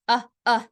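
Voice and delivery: short uh, uh as in tub; two separate, punched uh, uh vowel sounds; isolated vowel. Shown as a demonstration. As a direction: repeat uh, uh as a phonics sound. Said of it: "have the same vowel sound".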